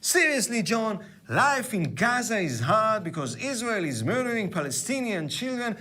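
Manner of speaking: mock earnest. Mock plea addressed to John Oliver.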